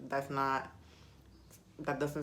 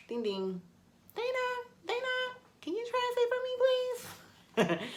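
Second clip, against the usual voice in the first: mock coworker; 4 dB louder than usual. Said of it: "they be like".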